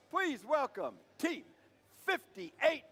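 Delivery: usual speaking voice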